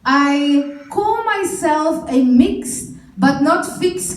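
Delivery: loud, slow, low spoken word delivery; spoken word poem. Acted. Poet performing her mixed but not fixed piece, loud and slow. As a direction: perform it like slam poetry on stage.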